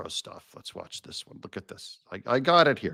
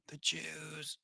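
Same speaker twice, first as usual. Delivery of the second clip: very soft, under the breath; mock hushed insinuation; 18 dB quieter than usual. Host voicing the pundit's supposed insinuation, mock hush. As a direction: drop it to a secretive near-whisper.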